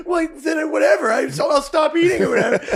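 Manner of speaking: put-on high, loud sulky girlfriend voice; mock ex-girlfriend. Ex sulking about being called fat, high loud whine. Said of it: "she'd be like".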